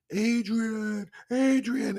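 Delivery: very high, long crying call; calling Adrian. Retold or imitated. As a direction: yell for her across the ring. Famous Rocky call, far, very high.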